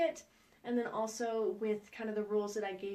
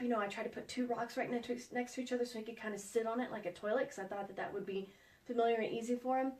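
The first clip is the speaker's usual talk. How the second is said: ordinary talk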